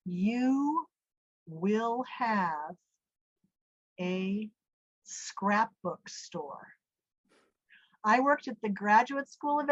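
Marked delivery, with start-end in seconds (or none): 0.1-6.7 slow, solemn voice with long pauses; big voice. Retold or imitated. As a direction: intone it like a divine command, word by word. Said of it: "this big voice goes".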